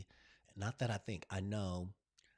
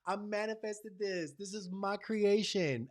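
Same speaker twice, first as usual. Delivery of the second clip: gushing gratitude; 10 semitones higher than usual. Retold or imitated. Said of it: "I'm just like".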